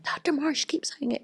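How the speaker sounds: whispered, barely voiced complaint; low whisper. Nurse telling charge nurse the doctor hangs up, whispering. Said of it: "my voice is really low".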